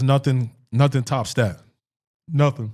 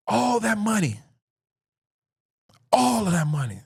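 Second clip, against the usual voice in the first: imitated amazed complaint; retold disbelief; 7 semitones higher than usual. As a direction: marvel at lost riches, pitched up. Retold disbelieving question to father.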